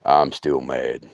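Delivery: low, slow sulky thumb; grumpy thumb voice. Retold or imitated. The injured thumb refusing to be used, low sulky grumble.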